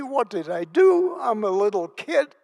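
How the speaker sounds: put-on high, loud, frightened child's questions; child's fear. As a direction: relive a scared little kid's panic. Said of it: "what scared me is".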